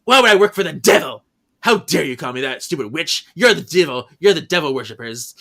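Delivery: Emily furious at the sisters; livid denial. Retold or imitated. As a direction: throw the accusation back. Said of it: "she is livid".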